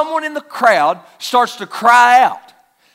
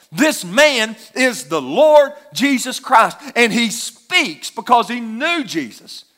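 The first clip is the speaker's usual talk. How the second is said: ordinary talk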